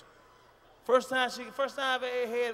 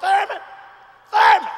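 shouting a name through the house; calling for Thurman; 9 semitones higher, 12 dB louder than usual. Acted. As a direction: yell Thurman down the stairs. Calling out to the husband.

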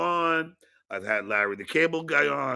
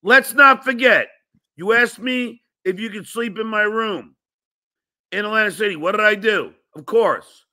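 loud, heated rant pitched higher; angry rant; 4 semitones higher, 5 dB louder than usual. Real, not acted. Host ranting at the guest who turned on him, loud and angry. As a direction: tear into him for his ingratitude.

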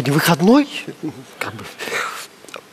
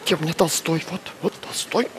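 soft low whisper; temple whisper; 9 semitones lower, 10 dB quieter than usual. Acted. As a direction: murmur it under your breath. Librarian whispers to herself.

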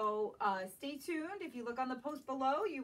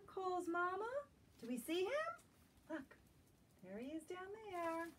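gentle, lilting storybook read-aloud; reading to children; 3 semitones higher than usual. Acted. Teacher reading a picture book to young children, lilting. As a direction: draw little listeners into the story.

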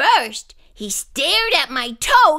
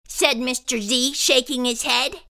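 usual speaking voice

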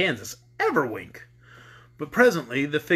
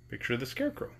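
hushed aside; 3 semitones lower, 11 dB quieter, faster than usual. A narrator's reading.

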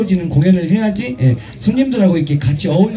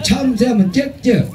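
slow, emphatic instruction; teaching the response; 6 semitones higher, slower than usual. Shown as a demonstration. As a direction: spell out the rule for the crowd. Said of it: "참새하면 짹짹해야지".

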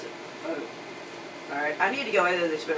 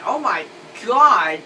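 drawn-out, louder exclamation of disbelief; exasperated; 4 semitones higher, 8 dB louder, slower than usual. Real, not acted. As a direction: let it burst out slowly, pitch jumping. Exasperated at the kid in video.

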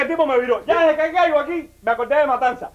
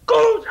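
high, loud mock hit noise; sharp punch sound; 9 semitones higher, 4 dB louder than usual. Retelling the street fight, a loud punch noise. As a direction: spit out the impact noise. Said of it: "el tipo estaba haciendo esto".

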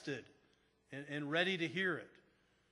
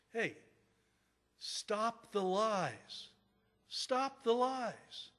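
drawn-out, higher-pitched retold order; emphatic slow demand; 6 semitones higher, much slower than usual. Pastor confronting an upset caller, slow and emphatic. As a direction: stretch each word out, rising in pitch on the repeat.

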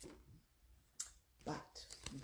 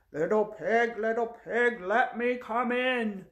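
big wolf voice; 5 semitones higher, 12 dB louder than usual. Shown as a demonstration. Teacher showing children the wolf's line, loud booming wolf voice. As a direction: boom it out, stretching the end. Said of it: "your very low wolf voice".

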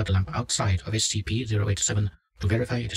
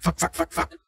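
rapid high-pitched swearing; panicked yelling; 8 semitones higher than usual. A narrator's reading. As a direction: yell the swearing in a burst of panic.

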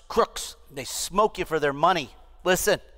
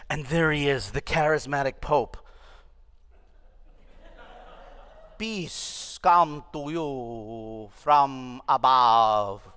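mock reverent announcer; 6 semitones lower, much slower than usual. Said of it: "the guy on the BBC says".

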